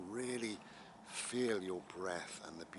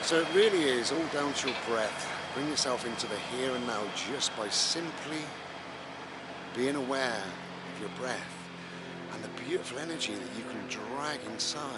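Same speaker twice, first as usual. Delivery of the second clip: talking over a loud tractor; tractor rumbling behind; 3 semitones higher, 11 dB louder than usual. Real, not acted. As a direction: raise the voice over engine noise. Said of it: "Sorry about the tractor".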